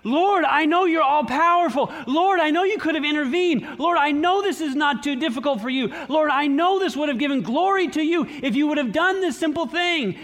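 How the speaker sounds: high-pitched, pleading prayer of frustration; frustrated longing. Acted. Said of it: "the frustration, the longing".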